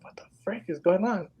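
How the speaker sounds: hushed, re-enacted disbelief; bewildered whisper. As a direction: whisper it, baffled, under your breath. Retold bewilderment at gagging child.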